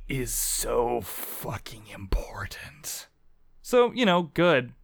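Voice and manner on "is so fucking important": breathy hushed whisper on family; mock dramatic whisper. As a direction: whisper it like a solemn secret.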